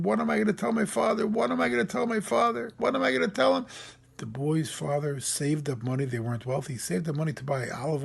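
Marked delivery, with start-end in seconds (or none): 0.0-1.3 put-on distraught boy. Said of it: "And the boy was screaming". 1.3-3.7 put-on distraught boy. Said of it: "And the boy was screaming".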